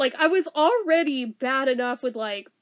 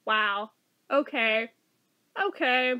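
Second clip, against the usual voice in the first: ordinary talk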